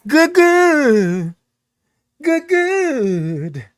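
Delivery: high swooping goods, then one endless wavering note; playful silly greeting. Shown as a demonstration. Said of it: "Or you can say".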